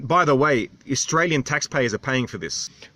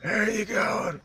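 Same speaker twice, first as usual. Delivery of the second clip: higher, slowed imitation of the old islander; Norfolk Island local's voice; 9 semitones higher, 3 dB quieter, much slower than usual. Vlogger mimics the islander's greeting, slow and high. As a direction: mimic his drawn-out greeting. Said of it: "He talks like that".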